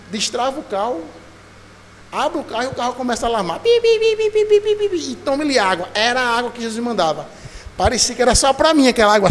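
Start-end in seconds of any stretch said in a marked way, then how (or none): 3.6-5.1 high, loud mock alarm beeping; car alarm beeps. Preacher mimics his car alarm in the rain, high loud beeping. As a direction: beep like a blaring car alarm.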